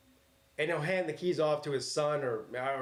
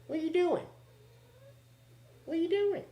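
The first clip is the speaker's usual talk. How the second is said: very high sing-song pet voice; high-pitched talk to a pet; 14 semitones higher than usual. Real, not acted. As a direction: coo at the cat in a high voice. Talking to the cat.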